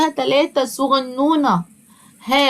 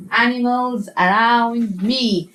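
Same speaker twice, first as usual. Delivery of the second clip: Animals Around Me spelled out very slowly, each word drawn apart; slow modelled title; much slower than usual. Shown as a demonstration. Teacher modelling the title slowly for first graders. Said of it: "نعلمهم إزاي ينطقوا".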